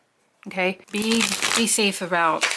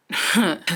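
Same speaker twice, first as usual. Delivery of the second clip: quick amused chuckle; a laugh at the answer she foresees; short laugh. Real, not acted. Reader to viewers, laughing at their likely answer. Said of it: "sorry, I'm laughing".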